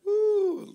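a high woo; high whoop. Real, not acted. Pastor whoops to liven up the room, high woo. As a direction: whoop at the crowd.

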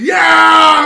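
high, loud roar; crowd screaming. Acted. Everybody screaming as they march out, loud roar. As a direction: scream it with the mob. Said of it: "everybody starts screaming".

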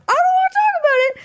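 very high, fast, upset squeal; shrill panicked refusal. Retold or imitated. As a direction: blurt it out in a frantic high squeak. Retold refusal, escalating.

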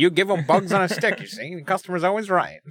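lightly voiced old-timey businessman; a hint of an old-time shopkeeper. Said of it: "I like the return of old-timey voice".

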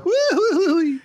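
Goofy's laughing woo-hoo yell; cartoon dog hoot, up high; Goofy holler. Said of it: "We both did it anyway".

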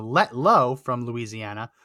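ordinary talk, nothing marked

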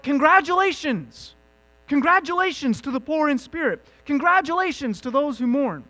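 high, loud cheer of congratulations; hearty congratulations. Acted. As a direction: proclaim it like good news to a crowd.

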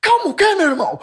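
angry man yelling back when told to calm down; furious retort. Retold or imitated. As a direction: throw it back like gasoline on a fire. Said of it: "É pior".

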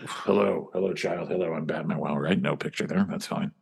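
low, gruff Batman voice. Acted. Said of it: "Hold on".

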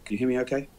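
audio dropping out mid-line; corrupted glitchy audio. Real, not acted. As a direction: voice fading as the connection breaks. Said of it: "I think the audio is cutting out".

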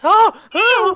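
very high, loud frightened cries; mock panicked gasps. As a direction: gasp out shrieks like a horror-film victim. Imitates panicked wife in The Shining.

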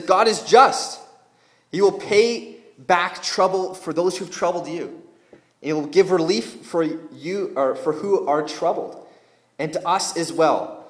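loud, forceful Bible reading; scripture reading aloud. Acted. Preacher reading God's promise to the troubled, forceful reading. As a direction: proclaim the verse firmly to the room.

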